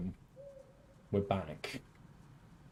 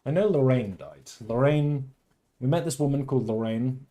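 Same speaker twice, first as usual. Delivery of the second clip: ordinary delivery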